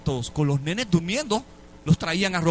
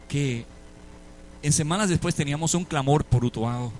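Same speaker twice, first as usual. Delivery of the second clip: usual speaking voice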